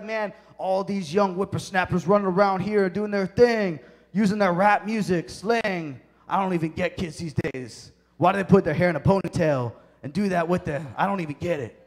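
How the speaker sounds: put-on loud, grumbling old-timer voice; hammed-up cranky grandpa griping about the young; upset old man. Preacher acts out old Solomon ranting about kids, upset old man. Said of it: "he's old, he's upset. He's like".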